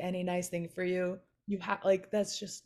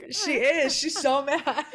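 high and loud, amused at the cat; amused laughing; 6 semitones higher, 7 dB louder, slower than usual. Real, not acted. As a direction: giggle about how grumpy she looks. Laughing about grumpy cat face.

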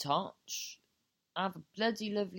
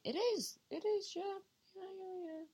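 squeaky, much higher voice; high pitched; 14 semitones higher, 4 dB quieter than usual. Real, not acted. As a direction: pitch way up, nervously. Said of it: "Why have you gone high pitched?".

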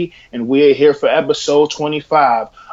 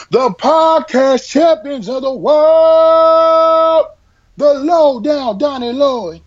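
ringmaster; 10 semitones higher than usual. Acted. Ringmaster-style introduction of the hosts.

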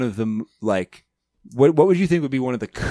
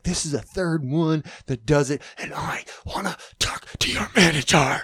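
whisper yelling; 4 semitones higher, 3 dB quieter than usual. Retold or imitated. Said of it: "he's like".

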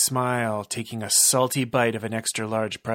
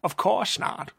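creepy villain; 7 semitones higher than usual. Acted. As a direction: relish every word like a creep. Villain monologue.

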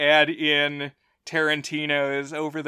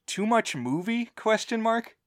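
rising quizzical tone; quizzical reading; 7 semitones higher than usual. Retold or imitated. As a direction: read it as a puzzled question. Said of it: "that's my bullet point is".

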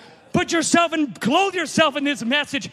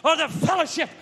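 fiery preaching; 4 semitones higher than usual. Acted. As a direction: stir the congregation up. Full-force preaching.

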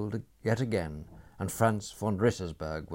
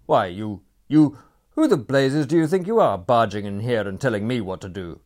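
furious outburst; 4 semitones higher, 13 dB louder than usual. A narrator's reading. Franz furious at Sebastian.

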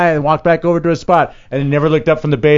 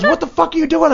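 high mock angry bassist; bassist snapping. Bassist scolding him onstage, high mock anger. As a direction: play the annoyed bass player. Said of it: "he goes".

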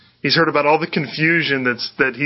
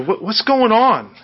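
higher, drawn-out call into a crowd; half-shouted question, pitched up; mock calling out; 8 semitones higher, slower than usual. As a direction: imitate a man shouting out, unsure who is there. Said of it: "He's just sort of shouting out".

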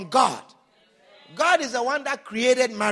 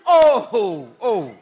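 loud, laughing swoon; 6 semitones lower, 9 dB louder, slower than usual. Retold or imitated. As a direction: coo like someone smitten. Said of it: "And you think".

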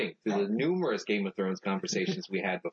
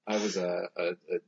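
normal talking voice

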